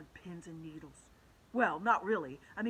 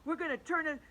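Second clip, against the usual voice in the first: higher, louder panicked shout; 7 semitones higher, 8 dB louder than usual. Acted. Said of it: "shouted Michael".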